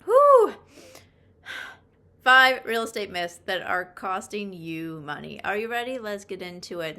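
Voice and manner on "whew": loud, high relieved exhale; relieved whew. Real, not acted. Host recovering from a coughing fit, whew. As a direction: blow out a big whew of relief. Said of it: "Okay, we're good".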